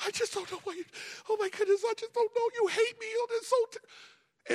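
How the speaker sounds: high, far-off falsetto whine; hammed-up tearful wife in a squeaky high voice; whiny wife. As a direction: play the wounded wife, pleading and dramatic. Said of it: "And she said".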